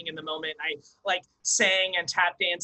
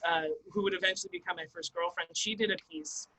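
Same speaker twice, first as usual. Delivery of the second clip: usual speaking voice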